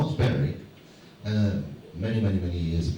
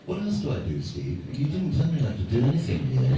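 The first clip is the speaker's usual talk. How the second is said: normal talking voice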